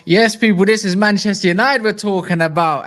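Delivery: loud triumphant declaration. Real, not acted. Host booming his catchphrase to viewers. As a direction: belt out the team pride.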